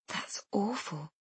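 very soft and slow; hushed sympathy. A narrator's reading. Friend hears of a lost baby, hushed sympathy. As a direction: murmur it slowly, in sympathy.

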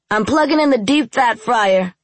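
loud, high call across the house. A narrator's reading. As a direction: call it out from another room. Calling out from the kitchen.

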